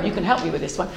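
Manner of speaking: ordinary delivery, nothing marked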